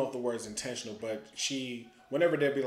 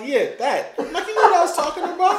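laughing; 12 dB louder than usual. Retold or imitated.

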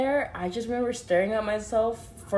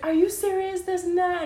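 much higher, outraged questions; mock boss losing patience; hammed-up outraged boss; incredulous boss; 12 semitones higher than usual. Angry boss on phone.

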